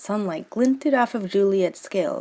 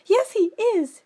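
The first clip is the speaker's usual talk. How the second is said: put-on much higher, petulant girl; Juliet's voice; 3 dB louder than usual. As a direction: snap back like a sulky teen. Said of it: "still quite angry".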